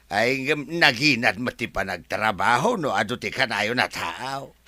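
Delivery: ordinary talk, nothing marked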